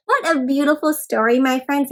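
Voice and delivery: ordinary delivery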